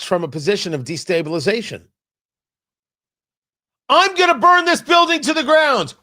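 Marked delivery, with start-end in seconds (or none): 3.9-5.9 loud, high mock threat; hammed-up shout, raised in pitch. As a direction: bellow an outrageous opening gambit. Voices an extreme opening threat.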